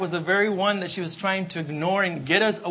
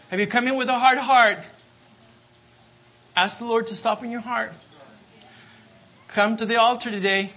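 ordinary talk, nothing marked

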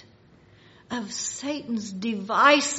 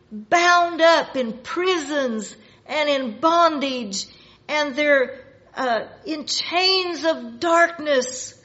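loud, higher, drawn-out pulpit emphasis; building, raised-voice sermon delivery; forceful preaching; 5 semitones higher, 7 dB louder than usual. Real, not acted. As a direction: preach it hard to the congregation.

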